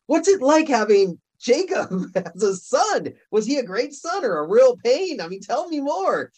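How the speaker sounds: high, chatty curiosity with a laugh; eager fan. Pretend. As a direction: pepper him with eager questions. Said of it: "you go".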